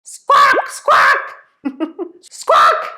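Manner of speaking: high, harsh squawks broken by laughter; seagull squawk. Shown as a demonstration. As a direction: squawk the word like a gull, then giggle. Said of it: "squawk like a seagull".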